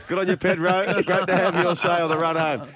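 laughing sign-off. Real, not acted. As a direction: wrap it up through a laugh. Host laughs signing off caller.

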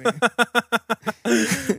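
high cackling witch laugh. Acted. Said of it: "That's my witch laugh".

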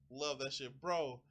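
normal talking voice

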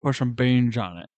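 loud bossy put-on British voice; hammed-up British gent ordering toast; loud mock British accent giving orders. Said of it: "I'm not British".

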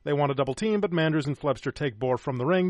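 ordinary talk

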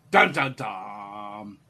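low, slow dramatic sting; dramatic dun dun dun. Pretend. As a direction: sing it like a suspense cue.

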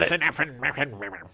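garbled comic cussing; mock swearing. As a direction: sputter mock curses, fading as you go. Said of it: "had him swear a lot".

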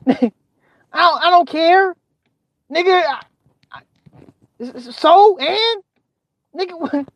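high, stammering disbelief; so unimpressed the words come out in pieces; exasperated dismissal. Acted. Viewer unmoved by streamers' bragging, incredulous.

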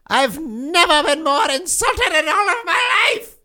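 theatrically insulted demon; mock outrage. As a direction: play the offended demon king. Demon offended by host.

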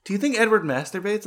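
squeaky earnest question; mock high question. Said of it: "Kate is asking my daughter".

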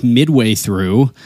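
usual speaking voice

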